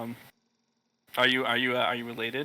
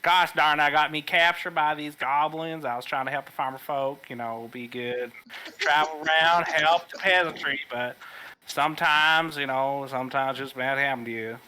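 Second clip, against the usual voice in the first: put-on southern accent; 2 semitones higher than usual. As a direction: play a folksy Southern country boy. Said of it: "speaking in a southern accent".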